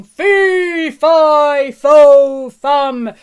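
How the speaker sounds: loud giant chant ending in a falling scream; giant shouting. A narrator's reading. Giant on the falling beanstalk, bellowing then screaming. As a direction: bellow it, then yell as you fall. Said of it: "they heard him shouting".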